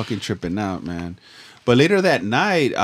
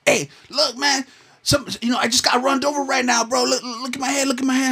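much higher mock old man; 14 semitones higher than usual. Injured old man showing the runner his head, high mock voice. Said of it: "there's this old man".

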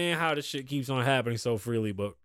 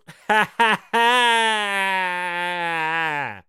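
mock giggle, loud; imitated giggle; 2 semitones higher, 6 dB louder than usual. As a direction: giggle out her laugh as a tease. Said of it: "As she laughed".

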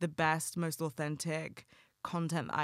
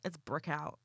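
put-on New York accent; 3 dB quieter than usual. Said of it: "so I can be like".

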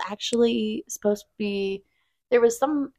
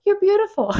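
sweet high voice; 11 semitones higher than usual. Retold or imitated. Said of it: "she's like".

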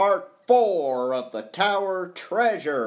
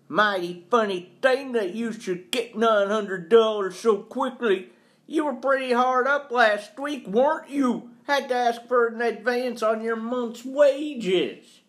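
put-on high, forceful, scornful old employer; scornful accuser; 8 semitones higher, 4 dB louder than usual. Employer doubts caretaker's honest money, scornful. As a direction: pitch it up and pour on disbelief.

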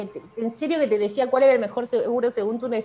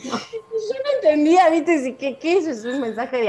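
baffled; 6 semitones higher, 3 dB louder than usual. Real, not acted. Puzzled by husband's remark.

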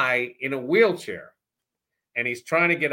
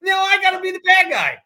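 much higher, louder exasperated yelling; furious shout; 12 dB louder than usual. Retold or imitated. Host replaying his yell at Weeze: exasperated shout. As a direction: blow up at him, voice cracking with outrage.

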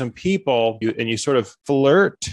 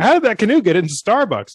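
higher, louder mock astonishment; mock amazed audience; 8 semitones higher, 4 dB louder than usual. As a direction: gasp it out like a baffled crowd.

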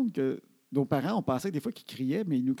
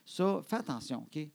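softer, measured, earnest parent; gently ask, keeping it reasonable; calm parental request; 6 dB quieter than usual. Retold or imitated. Father calmly asks Annabelle to be careful, soft earnest request. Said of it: "Ça ressemblait à ça".

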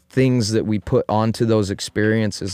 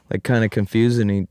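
ordinary talk

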